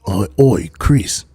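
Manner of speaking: mock British tough-guy voice; Jason Statham. Accusing Chris of racism, mock hard-man impression. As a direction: start low with a British hard-man accent. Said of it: "Jason Statham".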